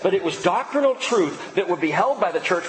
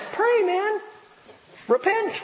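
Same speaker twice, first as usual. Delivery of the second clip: high, ringing call to pray; urgent exhortation. Acted. Preacher urging hotheads in the church to repent, high call. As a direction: cry out to repent.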